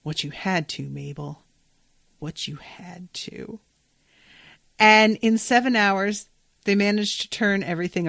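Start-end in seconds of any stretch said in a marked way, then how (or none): 0.0-1.4 quietly grave, a touch softer; soft solemn. Retold or imitated. Dipper consoles Mabel, softly solemn. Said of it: "And Dipper says". 2.2-3.6 much softer, slow, fading repeat; near-whispered echo. Retold or imitated. Dipper repeats his comfort to Mabel, fading hush. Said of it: "And Dipper says".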